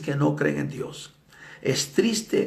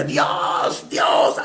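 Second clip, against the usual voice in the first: drawn-out imitated shriek; crazed screaming. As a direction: howl it like a madman. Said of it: "gritaba alarido como un esquizofrénico".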